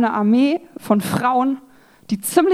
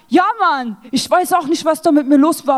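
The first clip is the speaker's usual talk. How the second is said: higher, a touch louder mock street talk; lightly voiced defiant contestant; 4 semitones higher, 3 dB louder than usual. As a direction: play the contestant brushing it off. Quoting the TV contestant.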